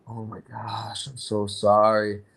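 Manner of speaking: mock-sympathetic, drawn-out condolence; gushing sympathy. People consoling him over his brother, gushing sympathy.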